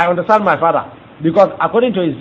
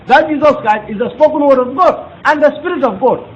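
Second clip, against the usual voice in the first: ordinary talk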